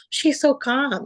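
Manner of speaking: watching her sister teach, amazed. Retold or imitated. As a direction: marvel at what you just saw. Gasps, admiring her sister.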